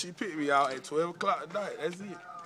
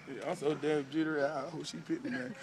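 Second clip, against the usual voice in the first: normal talking voice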